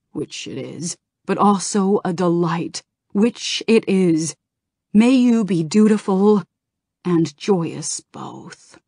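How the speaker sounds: a hint of a god speaking through him, slow and grave. A narrator's reading. Calic channels Norgannon's message to Jaina, lightly otherworldly and grave. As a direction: let something ancient speak through the words, lightly. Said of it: "his voice changed, became deeper, became other".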